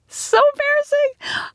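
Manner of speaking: laughing through embarrassment, high; giggle, flustered and blushing; embarrassed laugh. Real, not acted. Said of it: "I'm literally blushing".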